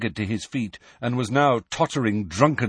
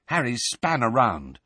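plain reading voice

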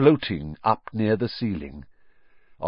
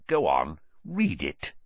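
menacing; 6 semitones lower than usual. Acted. Vernon threatens Harry.

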